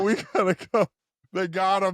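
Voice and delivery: high laughing mock panic. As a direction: squeal while laughing.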